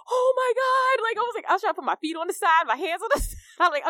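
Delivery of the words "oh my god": high, loud panicked oh my God; sudden terror on the water slide; panicked cry. Retold or imitated. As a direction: cry out in fright mid-drop.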